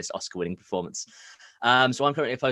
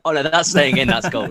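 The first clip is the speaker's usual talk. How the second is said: loud, high, delighted through laughter; cracking up at a friend's blooper; laughing; 8 semitones higher, 11 dB louder than usual. Real, not acted.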